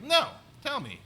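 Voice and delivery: high, loud push to hear it; eager insisting. Retold or imitated. Comic daring a heckler, loud and high. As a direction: jump up in pitch, daring him. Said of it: "And I was like".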